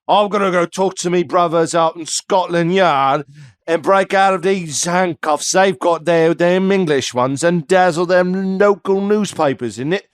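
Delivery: hammed-up English accent, boasting; broad, cocky put-on English accent; mock English accent. Voices Houdini in put-on English accent.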